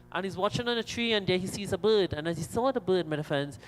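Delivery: ordinary talk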